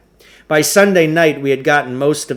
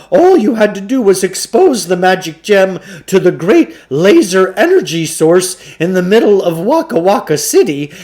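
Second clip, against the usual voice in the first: folksy salesman Klugarsh; 6 semitones higher, 6 dB louder than usual. Acted. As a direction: sweet-talk the boy like a fast-talking shopkeeper. Klugarsh sells the course.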